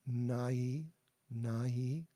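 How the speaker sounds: "nahi" with a clear breathy h in the middle; "nahi": let the h be heard; audible h. Shown as a demonstration. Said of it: "There's a h sound there".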